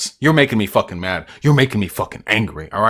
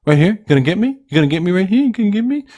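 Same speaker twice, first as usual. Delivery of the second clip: rising, teasing dares climbing higher; mock taunt; 8 semitones higher, 3 dB louder, faster than usual. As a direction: goad them like a schoolyard bully. Taunting chat to come at him.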